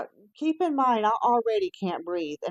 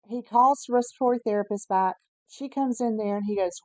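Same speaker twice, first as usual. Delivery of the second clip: ordinary talk, nothing marked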